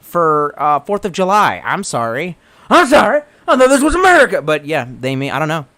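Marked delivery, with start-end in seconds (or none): 2.7-3.2 loud, high hammed-up outburst; mock indignant shout. Streamer excusing his Fourth of July drinking, mock indignant shout. 3.5-4.4 loud, high hammed-up outburst; mock indignant shout. Streamer excusing his Fourth of July drinking, mock indignant shout.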